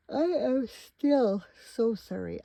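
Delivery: talking through a yawn. Real, not acted. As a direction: yawn stretching the words out slowly. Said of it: "I keep yawning on you".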